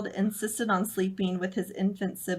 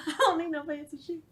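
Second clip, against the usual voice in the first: put-on high Southern kid voice while laughing; laughing through a Southern twang; giggling Southern child; Southern accent; 8 semitones higher than usual. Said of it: "Southern accents".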